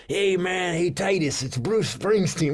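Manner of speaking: imitating [name]. [name] calling a comedian, lightly voiced and casual. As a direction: greet like a famous rocker, casual. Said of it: "he goes".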